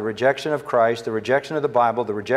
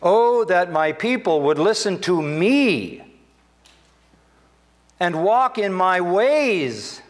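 higher, very slow, longing pulpit cry; slow, higher lament as God; lamenting preaching; 7 semitones higher, 3 dB louder, slower than usual. Acted. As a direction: ache over the people who won't listen. Repeating God's lament.

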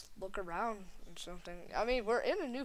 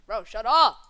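loud angry yell; yelling shut up; 15 dB louder, slower than usual. Acted. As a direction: shout him down. Angry outburst at teammate.

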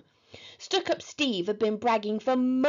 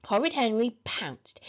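ordinary delivery, nothing marked

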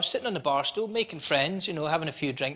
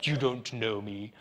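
put-on drunk over-enunciation; 5 semitones lower, 4 dB quieter than usual. Drunk asking to get into the club, over-enunciating. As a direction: play a drunk faking normal speech. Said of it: "I'm talking normally and all that".

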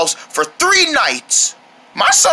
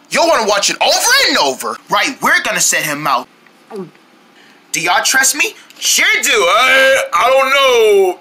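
usual speaking voice

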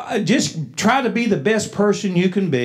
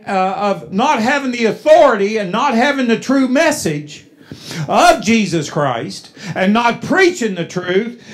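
preaching; 6 semitones higher, 5 dB louder than usual. Acted.

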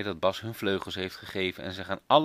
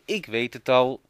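louder, eager exclamation; sudden idea; 3 semitones higher, 6 dB louder than usual. Acted. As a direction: blurt it out with discovery. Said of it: "Ineens roept Marianneke".

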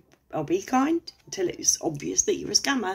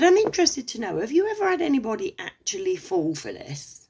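sarcastic question; 6 semitones higher, 3 dB louder than usual. Retold or imitated. Her sarcastic reply to scammer.

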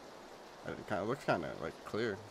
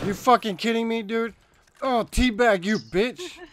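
high furious shouting; angry yelling; 11 semitones higher, 8 dB louder than usual. Real, not acted. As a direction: rage at the screen. Player gets killed and teabagged.

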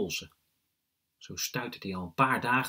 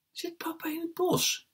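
put-on very high, breathy child question; wide-eyed little brother. A narrator's reading. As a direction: ask in awe as a small boy. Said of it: "vraagt Jesper met grote ogen".

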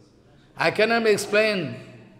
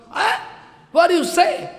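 high-pitched astonished outcry; shocked disbelief; 11 semitones higher, 4 dB louder than usual. Acted. Husband hearing he already took prasadam, shocked. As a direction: cry out as if stunned by the news. Acted shock at wife's account.

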